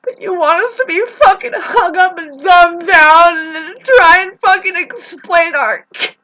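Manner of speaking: high, loud, anguished shouting; angry rant. Real, not acted.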